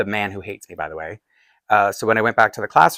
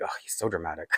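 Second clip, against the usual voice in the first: imitated scornful aside, low and soft; dismissive eye-roll; 5 semitones lower, 13 dB quieter, faster than usual. Male teacher dismissing an upset pupil, imitated eye-rolling scorn. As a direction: toss it off quietly, as if rolling your eyes. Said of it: "rolled his eyes".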